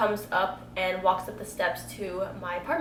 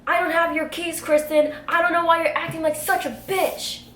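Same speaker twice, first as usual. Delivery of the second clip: higher, louder angry retort; angry man; 7 semitones higher, 5 dB louder than usual. Retold or imitated. Quoting the friend yelling at her, mock angry. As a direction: throw his insult back at her. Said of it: "He said something along".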